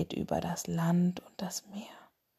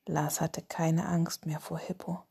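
sleep story narrator; 6 dB quieter than usual. A narrator's reading. Whispered bedtime story podcast.

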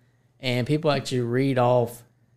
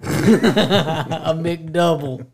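drawn out, laughing through it; 3 dB louder, much slower than usual. Real, not acted. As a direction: stretch it slow while laughing. Teasing friend, laughing.

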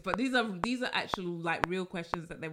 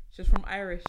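louder, hammed-up fake Irish accent on "She's from Irish"; bad Irish accent; 7 dB louder than usual. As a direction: throw out a terrible mock Irish accent. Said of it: "that's a terrible accent".

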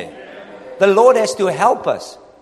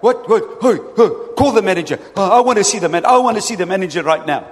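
louder, fast demanding customer calling for the manager; entitled customer; 4 dB louder, faster than usual. Retold or imitated. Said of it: "It's like".

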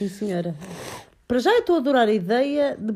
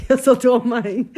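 stern; 2 semitones higher, 7 dB louder, slower than usual. Real, not acted. Mother playfully scolds her son.

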